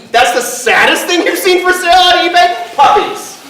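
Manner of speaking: high, rising disbelief; incredulous. Real, not acted. Host challenging an audience suggestion about eBay, loudly incredulous. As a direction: question it as if you can't believe it.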